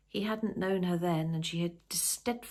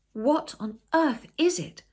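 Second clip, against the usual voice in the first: high, loud and slow alarm; alarmed question; 10 semitones higher, 9 dB louder, slower than usual. Acted. Simon rushing to his shocked wife, loud and alarmed. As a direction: demand it, startled and worried.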